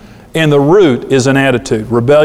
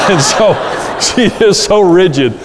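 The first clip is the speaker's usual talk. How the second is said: amused laughing; 5 semitones higher than usual. Real, not acted. Laughing at his own memory.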